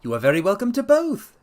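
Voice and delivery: a lightly lifted detective voice; Holmes voiced. A narrator's reading. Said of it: "said he".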